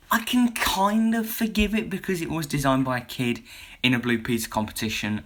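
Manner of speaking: ordinary delivery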